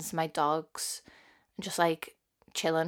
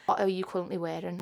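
ordinary talk

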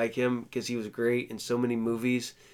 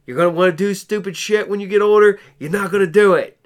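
stern mock warning; 5 semitones higher, 7 dB louder than usual. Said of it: "I'm gonna grab people before".

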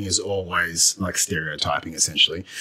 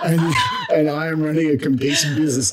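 higher, laughing through the words; laughing in disbelief; 8 semitones higher, 3 dB louder than usual. Real, not acted. Laughing at the absurd accusation.

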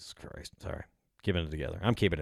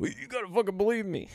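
much higher, whiny put-on plea; mock pleading insider; 12 semitones higher than usual. Mocking a UFO guy begging listeners to believe him. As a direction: beg in a squeaky mock voice.

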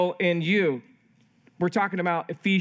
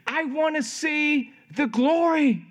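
high, slow mock wail of a small child; whiny little-kid plea, acted out; imitated crying toddler; 9 semitones higher, slower than usual. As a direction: lightly play a tearful toddler pleading with dad. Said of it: "She's crying and crying".

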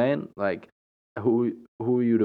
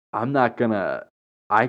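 ordinary talk, nothing marked

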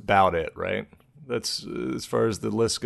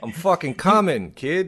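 higher-pitched mock South Boston accent; 6 semitones higher than usual. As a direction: ham up a Boston bedroom voice. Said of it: "doing the South Boston accent".

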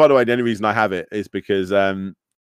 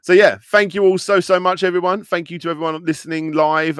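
ordinary talk, nothing marked

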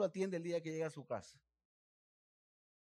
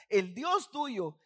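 loud, slow, higher emphatic reading; savoured emphasis; 5 semitones higher, 8 dB louder, much slower than usual. Shown as a demonstration. Preacher relishing his favourite verse, loud and slow. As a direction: lean into each word lovingly. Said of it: "me encanta esto".

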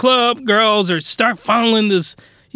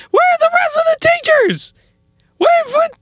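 much higher, disbelieving cry; high-pitched outraged rant; exasperated squeal. Real, not acted. Ranting about missing teachers.

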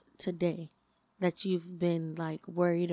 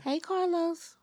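mock greeting; 11 semitones higher, slower than usual. Greets absent friend jokingly.

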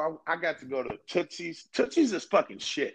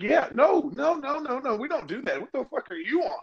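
mock refusal; 11 semitones higher than usual. Retelling the dude's refusal.

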